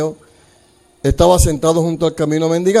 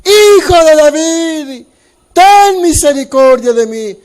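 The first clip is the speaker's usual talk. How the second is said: crying out louder; 7 semitones higher, 5 dB louder, slower than usual. Acted. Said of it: "clamaba mucho más alto".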